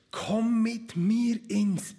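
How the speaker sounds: slow, lightly voiced temptress; urging seduction. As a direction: coax him slowly toward bed. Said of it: "drängte sie Josef".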